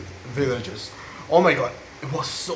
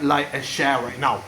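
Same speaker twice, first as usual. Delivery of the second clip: usual speaking voice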